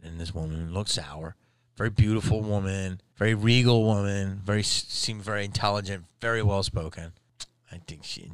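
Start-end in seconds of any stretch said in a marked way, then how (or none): none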